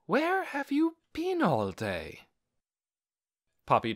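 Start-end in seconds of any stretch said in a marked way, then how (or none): 0.0-2.3 high, slow puzzled voice; a hint of a surprised older woman; puzzled housekeeper. A narrator's reading. Housekeeper greeting the returning boy, puzzled. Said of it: "she said".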